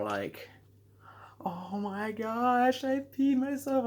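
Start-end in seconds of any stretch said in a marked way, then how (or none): 1.5-3.9 high, very slow, horrified wail; replaying the moment of horror, drawn-out and high; mock panic. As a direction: stretch it out in disbelief, pitched way up. Panicking on the plane.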